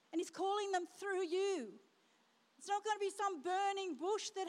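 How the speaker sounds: rising fervent preaching. Acted. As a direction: preach it with building passion. Preaching builds.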